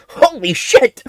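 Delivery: high, loud startled burst; mock exclamation. Real, not acted. Streamer reacting to his own rant, loud burst. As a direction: blurt it out in disbelief.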